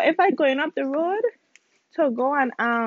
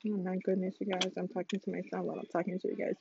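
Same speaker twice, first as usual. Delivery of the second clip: much softer, quick aside to camera; quiet aside; 4 semitones lower, 12 dB quieter, faster than usual. Real, not acted. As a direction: drop the voice and rush it. Quiet aside while son interrupts.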